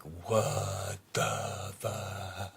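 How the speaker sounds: words stretched very slow, one by one; dragged out. Shown as a demonstration. Showing listeners how slowly to drag out the words. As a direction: drawl it out as long as you can. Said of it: "drag the words out like".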